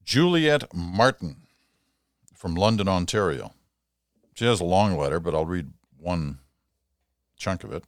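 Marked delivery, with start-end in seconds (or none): 0.0-3.5 announcing a name. Real, not acted. Host announces letter writer's name.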